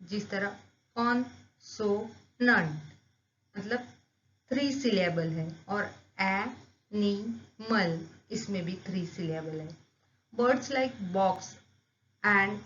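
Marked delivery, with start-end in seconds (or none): 0.9-2.8 "कॉन्सोनेंट" slowly split as con-so-nant; "कॉन्सोनेंट" broken into three slow beats; syllable by syllable. Shown as a demonstration. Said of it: "slowly pronunciation करेंगे". 6.2-8.1 "एनिमल" slowly split as a-ni-mal; "एनिमल" broken into three slow beats; syllable by syllable. Shown as a demonstration. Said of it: "slowly pronunciation करेंगे".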